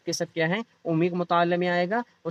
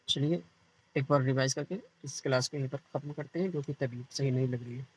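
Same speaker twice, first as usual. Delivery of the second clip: low, soft, drained sick voice; feeling unwell; 3 semitones lower, 6 dB quieter, faster than usual. Real, not acted. Teacher apologising for cutting the lesson short, unwell and subdued. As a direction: speak quietly through a bad head.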